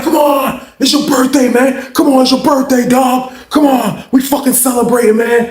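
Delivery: high, pressing party cheer; hyped celebrating. Retold or imitated. Hyped cellmate demanding birthday celebration, high excited urging. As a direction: imitate a cellmate bursting with excitement. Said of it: "he just all fucking hyped".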